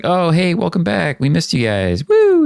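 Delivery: overjoyed hostess squealing a welcome; gushing welcome. Retold or imitated. Kentaro welcoming the returning group home, gushing high and excited. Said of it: "it's very much like".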